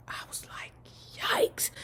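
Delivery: breathy whispered yikes. Real, not acted. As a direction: whisper it under your breath. Whispered reaction.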